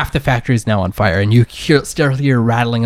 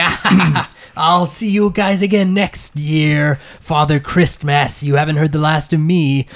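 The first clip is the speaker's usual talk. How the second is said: gloating villain; 3 semitones higher than usual. Acted. Game master as enemy sliding away to escape, gloating villain. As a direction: crow a hammed-up villain farewell.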